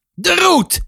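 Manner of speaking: veel hoger geroepen bevel; schelle uitroep. A narrator's reading. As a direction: roep het hoog uit als een boze moeder. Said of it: "riep Shaila". (hoog uitgeroepen)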